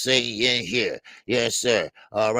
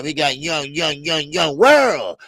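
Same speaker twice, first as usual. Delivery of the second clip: hype shout; 4 semitones higher, 8 dB louder, slower than usual. Real, not acted. Hyping a name to chat.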